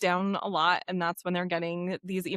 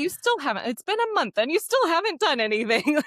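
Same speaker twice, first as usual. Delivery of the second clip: naggy reminder; 7 semitones higher than usual. Retold or imitated. Quoting a naggy reminder email.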